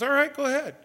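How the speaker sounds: mock permissive god. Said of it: "if God just goes".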